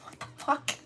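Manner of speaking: breathy whispered "what the hell"; whispered aside. Retold or imitated. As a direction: mutter it under your breath, unvoiced. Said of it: "I said".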